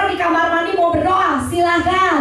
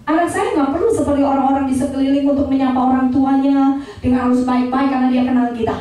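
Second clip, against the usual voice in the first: ordinary delivery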